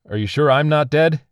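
high, rising, urgent question; loud questioning call. A narrator's reading. Dazed survivor questions the unseen voice, calling loudly. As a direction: call out in disbelief.

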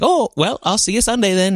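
high, sing-song Minnesota lilt, imitating the actress; chirpy Midwestern goodbye in a Minnesota accent. Quoting the show's sweet farewell in a Minnesota accent. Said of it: "In Minnesota" accent".

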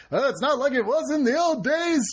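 lightly voiced cranky old man. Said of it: "old cranky men".

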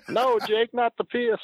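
very high falsetto squeal of protest; Honky Tonk Man. Retold or imitated. Wrestler begging Jake as it spills on him, mock falsetto squeal. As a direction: imitate a panicked plea in a squeaky falsetto. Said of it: "with his voice".

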